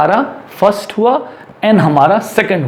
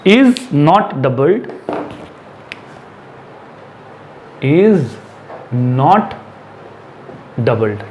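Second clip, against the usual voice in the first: very slow, long pauses between words; 4 semitones lower, much slower than usual. Shown as a demonstration. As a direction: dictate it one word at a time. Teacher repeats rule word by word. (एक-एक शब्द रुक-रुक कर)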